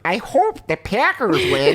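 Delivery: put-on high, slow childlike caricature; cherub-cheeked youngster. Young Wisconsin man hoping for his team, cherubic kid voice. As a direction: put on an innocent overgrown-kid voice. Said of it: "this cherub-cheeked people being like".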